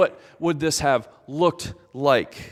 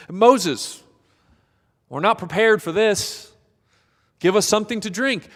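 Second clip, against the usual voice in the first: people crying out; 6 semitones higher than usual. Acted.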